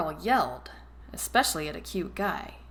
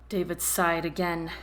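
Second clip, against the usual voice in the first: usual speaking voice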